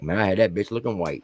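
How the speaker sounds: usual speaking voice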